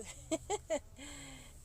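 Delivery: high-pitched giggle; short, high self-conscious laugh; embarrassed laugh. Real, not acted. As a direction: giggle at your own camera mishap. Embarrassed about dress on camera.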